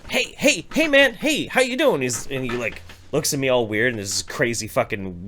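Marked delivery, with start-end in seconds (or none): 0.1-2.0 high-pitched eager hail; calling out. Retold or imitated. Hailing a weird passing stranger, calling out high. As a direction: call over a stranger in a high, friendly holler.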